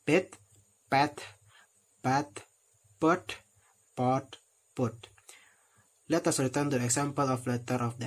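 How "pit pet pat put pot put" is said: pit, pet, pat, put, pot each said alone with a pause between; minimal pair demo. Shown as a demonstration. Teacher listing vowel-contrast words for learners, careful demo. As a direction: space out each word so the vowel is clear. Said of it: "give us six different words as follow".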